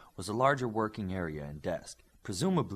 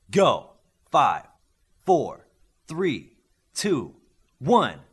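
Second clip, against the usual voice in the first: shouted, much louder countdown; 4 semitones higher, 11 dB louder, much slower than usual. Acted. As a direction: call the count like a referee. Counting down a contest.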